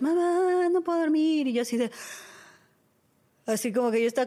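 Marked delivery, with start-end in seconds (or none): 0.0-1.5 put-on high, drawn-out child's whine; mock little-girl complaint, pitched up; whining daughter. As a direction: imitate a sleepless kid calling for mom. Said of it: "sale mi hija y otra vez".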